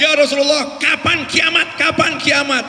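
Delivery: high, insistent calling out; imitated villager's shout. Villager calling to the Prophet about Judgment Day, shouting. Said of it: "Dia teriak-teriak".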